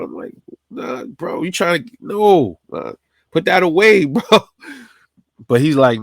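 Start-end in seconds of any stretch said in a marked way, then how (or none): 0.0-4.2 high, rising panic pleading to put the gun away; freaking out at a friend's gun; panicking. Retold or imitated. Panicked pleading with his friend about the gun on the seat. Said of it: "I'm panicking".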